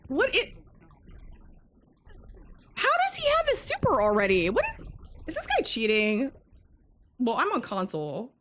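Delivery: can't believe what the other player just did; outraged disbelief. Real, not acted. Disbelief at opponent's super.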